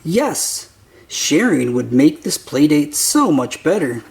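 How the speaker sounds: plain reading voice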